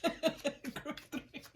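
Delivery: laughing. Real, not acted. Laughing while addressing group.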